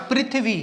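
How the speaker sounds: "पृथ्वी" as prith-vee, with ri; correct pronunciation. Shown as a demonstration. Teacher answering what the correct form is, "पृथ्वी" as prithvi. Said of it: "असली शुद्ध क्या है इसका?".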